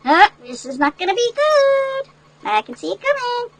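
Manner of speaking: mock dread. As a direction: warn that trouble is coming. Reader dreads what comes next.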